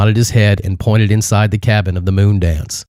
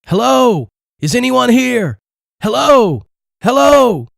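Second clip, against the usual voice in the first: screaming for anyone; slower than usual. A narrator's reading. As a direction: yell out searching the empty boat. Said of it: "began screaming".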